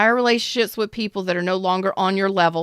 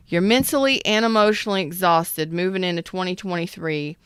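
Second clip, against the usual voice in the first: ordinary talk, nothing marked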